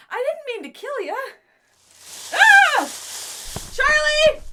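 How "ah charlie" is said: high, loud alarmed calls; loud frantic cries for Charlie; panicked shouting. Acted. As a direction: yell for help in a panic.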